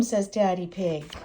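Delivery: usual speaking voice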